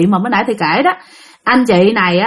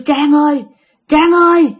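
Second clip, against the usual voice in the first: repeated high call of a name; 5 semitones higher than usual. Retold or imitated. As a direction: mimic a ghost calling her name. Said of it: "kêu".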